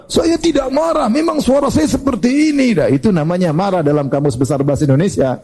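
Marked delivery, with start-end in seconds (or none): 0.1-2.7 high, insistent voice of a hothead denying he is angry; hot-tempered man. Retold or imitated. Preacher imitating a hothead who insists he isn't angry, high and defensive. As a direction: pitch it up and protest that this is just how you talk. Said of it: "jangan marah-marah".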